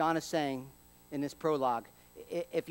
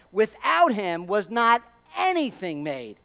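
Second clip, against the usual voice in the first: slow and louder, leaning on "Without"; emphatic; 4 dB louder, slower than usual. Shown as a demonstration. Preacher to congregation, emphatic slow verse. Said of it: "It's explicit. It's emphatic".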